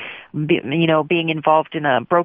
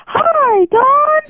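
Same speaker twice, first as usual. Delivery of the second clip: very high, slow, sing-song greeting; mock the pastor's squeaky welcome; teenage girl mocking the pastor's high voice, very high and slow; high-pitched pastor voice; 3 dB louder, much slower than usual. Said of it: "he always had that high-pitched voice".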